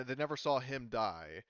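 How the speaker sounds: usual speaking voice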